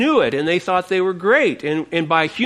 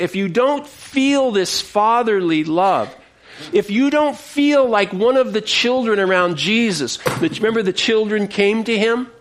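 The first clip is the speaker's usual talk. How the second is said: ordinary talk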